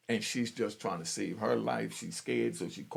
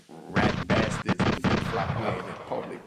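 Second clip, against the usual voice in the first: scornful insult; 4 semitones lower, 7 dB louder than usual. Real, not acted. Insulting trolls.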